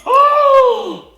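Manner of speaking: high, loud shriek of shock; shocked scream. Real, not acted. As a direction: cry out sharply as a nail snaps. Nail breaks while cooking.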